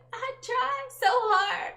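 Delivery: high, slow mock-whiny plea; mock whine. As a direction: whine it up high like a tired kid. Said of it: "I've been just so exhausted".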